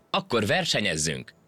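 plain reading voice

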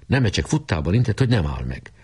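ordinary delivery

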